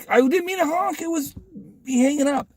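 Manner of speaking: high-pitched imitated excuse; pleading self-defence. Retold defensive explanation to the lady.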